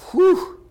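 high, loud exhaled whoop; whew. Acted. Preacher marveling at God's guidance, loud joyful whew. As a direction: let out a big joyful whew.

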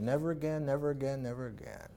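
repentant plea. Retold or imitated. David crying out for forgiveness, fading into a hush. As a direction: let it sink into a hushed vow. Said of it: "he cried out for forgiveness and he said".

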